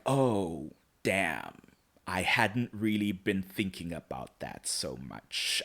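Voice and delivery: low, subdued, sinking realisation; understated realisation. Retold or imitated. Luke taking in an unwelcome thought, subdued and low. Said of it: "And Luke is like".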